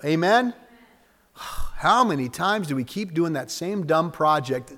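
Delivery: ordinary delivery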